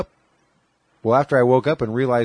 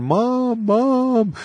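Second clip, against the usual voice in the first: high mock wail for mom, much higher; a mild imitation of sobbing out for mom; calling for mom, lightly played crying; retold crying; 13 semitones higher than usual. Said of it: "bawling my head off".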